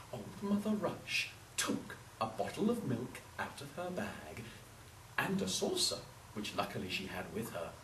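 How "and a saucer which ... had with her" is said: ordinary delivery, nothing marked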